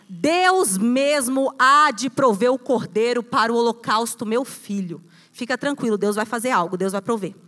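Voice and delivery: tender fatherly reassurance. Acted. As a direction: gently reassure the boy that God will provide. Abraham answers son Isaac.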